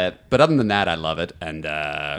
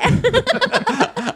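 high laugh. Real, not acted. Host laughs at mispronouncing name.

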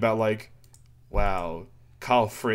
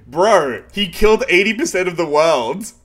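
loud, higher outburst; outraged; 7 semitones higher, 8 dB louder than usual. Real, not acted. Host ranting to cohost about a manga line, loud and outraged. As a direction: blurt it out in disbelief.

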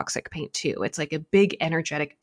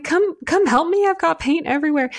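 very high, loud frantic plea for help; high, loud frantic call for help; panicked plea; 13 semitones higher, 6 dB louder than usual. Retold or imitated. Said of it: "I yell out to Megan".

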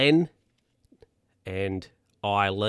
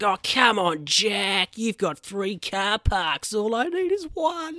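much higher, whiny begging voice; pleading whine; 11 semitones higher, slower than usual. Retold or imitated. Guy begging Jack for one car park, whiny. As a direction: plead like a hard-done-by bloke.

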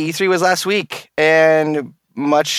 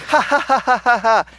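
mock laugh; 7 semitones higher than usual.

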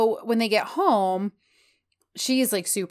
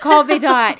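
louder insistent repeat; 6 semitones higher, 11 dB louder than usual. Retold or imitated. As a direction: Call Vedot louder and higher, pushing the point. Repeating the advice more forcefully.

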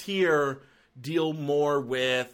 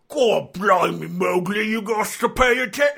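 Cockney drill sergeant Baloo; 6 semitones higher, 7 dB louder than usual. Retold or imitated. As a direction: bark it like a London drill sergeant in a Guy Ritchie film. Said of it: "Andy Serkis playing Baloo".

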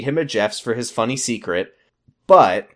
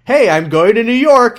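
high, loud, hammed-up cheer; mock excited announcement; 9 semitones higher, 7 dB louder than usual. Podcaster acts the boyfriend announcing his trip, mock excited. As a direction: announce the trip with exaggerated glee.

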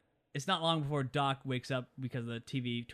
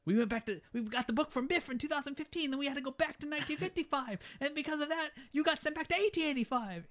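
higher, rushed recap in a put-on voice; Marty voice; 13 semitones higher than usual. Marty rattling off the plot to Doc in a higher voice. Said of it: "he's like".

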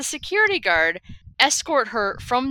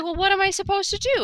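much higher put-on woman's voice; imitated customer; 11 semitones higher than usual. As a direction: quote her helpless question in a high voice. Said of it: "She's like".

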